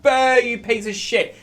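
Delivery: high, drawn-out mock booing; jeering audience. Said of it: "They're just like".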